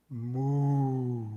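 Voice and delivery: long, smooth cow lowing; cow moo. Acted. Cow interrupts the chanting monk, drawn-out mooing. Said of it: "even louder, the cow goes".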